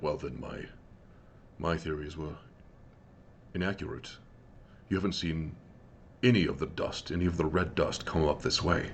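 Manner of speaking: a lightly voiced large man, questioning quietly; large man's voice. Game master voices the large man questioning Scosset, low and soft.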